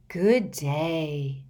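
slow airy seer's greeting; misty, dreamy voice. A narrator's reading. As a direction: drift the words out dreamily. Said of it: "her usual misty, dreamy voice".

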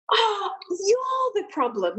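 high, drawn-out accusation; high-pitched mock outrage; mock angry yelling. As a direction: imitate an irate surgeon without real volume. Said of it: "He starts pointing at me loudly".

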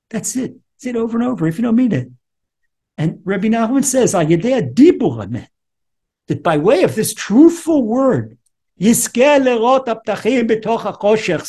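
high, urgent preacher's voice at full force; impassioned preaching. Acted. Rabbi urging suffering listeners to pray, impassioned high preaching. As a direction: preach it, pleading with the flock.

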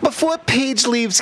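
usual speaking voice

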